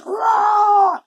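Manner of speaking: loud breathy growling roar; mock bear roar. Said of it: "The bear went".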